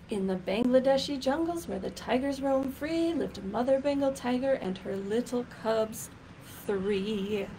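lively, sing-song picture-book reading; reading to children. Acted. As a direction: read it out like storytime for a class.

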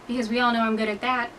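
ordinary talk

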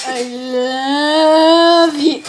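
loooong drawn-out love; gushing. Acted. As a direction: stretch it out into a swoon. Fan interviewer gushes at Sal.

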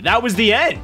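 ordinary talk

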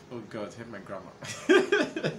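chuckling through an embarrassed aside; amused self-mocking laugh; laughing at herself. Real, not acted. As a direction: laugh it off slowly at your own slip. Laughing at own stumble.

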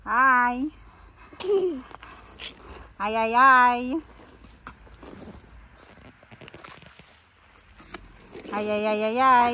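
loud, repeated calling hi. Real, not acted. Calling to child outside.

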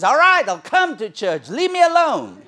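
loud, high fed-up surrender; fed-up yell, giving up; exasperated neighbour. Retold or imitated. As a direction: shout it like you give in.

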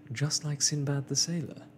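a touch of laughter in the delivery; laughing. A narrator's reading. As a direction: say it with mild amusement.

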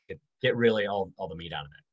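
normal talking voice

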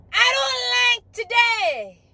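high, loud, slow drawn-out shout; shouted whine. Acted. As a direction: bellow the sulk. Catchphrase shouted.

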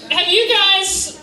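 usual speaking voice

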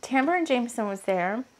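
normal talking voice

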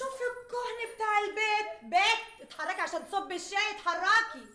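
high-pitched nagging scold; mother nagging the girl to go pour the tea. Acted. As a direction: snap at the lazy girl.

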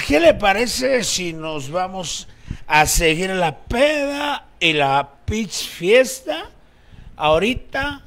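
put-on slow, drawn-out drunk talk; drunk compadre. Drunk compadre proposing to keep partying, drunk and slow. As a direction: drag the words out with tipsy pauses. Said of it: "De borrachos".

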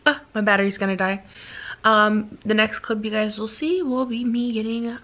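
normal talking voice

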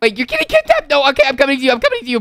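high-pitched panicked reply; alarmed. Acted. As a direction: panic and rush to the rescue. Alarmed at friend's kidnapping.

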